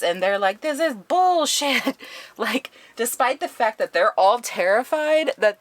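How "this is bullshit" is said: high, drawn-out mock outrage; slow, whiny-high complaint; indignant protest. Residents reacting to the curfew, mock indignant.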